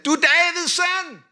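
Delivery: high, loud desperate plea; crying out loud. Retold or imitated. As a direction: call out to be heard over a crowd. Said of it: "Højere og højere råbte han".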